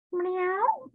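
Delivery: high rising mock meow; showing the class how her cat meows; imitated meow. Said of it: "This is what he's doing".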